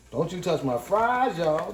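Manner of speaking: drawn out, teasing, each word stretched slowly; slow mock warning. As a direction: savor the threat playfully. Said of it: "one order of don't you touch my fries".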